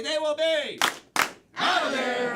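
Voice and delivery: shouted catchphrase; loud. Shown as a demonstration. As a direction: punch out of there loud. Catchphrase chant.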